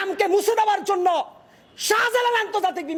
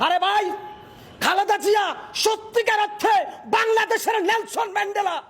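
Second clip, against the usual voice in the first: ordinary talk, nothing marked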